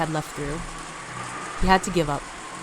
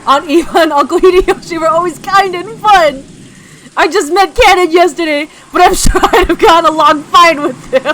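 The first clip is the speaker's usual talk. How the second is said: anguished cry; 9 semitones higher, 10 dB louder than usual. Acted. Grieving continuation of rant.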